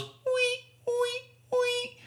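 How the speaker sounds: very high soft squeaks; squeaking window. Retold or imitated. Window washer's squeegee, very high soft squeaks. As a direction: squeak like a wiper on glass.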